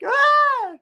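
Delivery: very high, loud pretend shriek; hammed-up cry at being jabbed; mock stabbed scream. Anna jabbed with the antidote, mock shriek.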